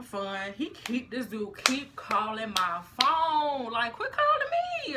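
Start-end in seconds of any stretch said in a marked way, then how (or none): 3.7-5.0 high, strained exasperation; exasperated plea. Retold or imitated. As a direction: beg him to stop calling, fed up. Annoyed at repeated calls.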